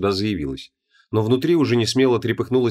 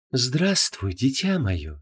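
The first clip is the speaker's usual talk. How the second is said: soft, sweet seductive voice; sultry demon goddess; 2 semitones higher, 4 dB quieter, much slower than usual. Acted. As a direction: purr it like a temptress. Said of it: "приветливо улыбнулась демоническая красавица".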